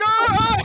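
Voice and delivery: high, loud hammed-up screams; mock panicked screams. Workers crushed by a robot arm, loud mock screams.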